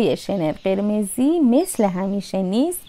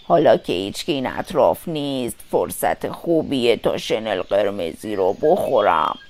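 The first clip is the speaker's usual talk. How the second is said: very low, softened wolf voice; wolf talking to himself; 5 dB quieter than usual. A narrator's reading. Wolf plots to eat Little Red, deep self-talk. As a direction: scheme to yourself in a deep voice. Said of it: "با خودش گفت".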